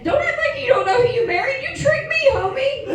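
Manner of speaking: very high, sing-song scolding; mock indignant retort. Wife answering her baffled husband, mock indignant high retort. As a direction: climb way up high in exaggerated indignation.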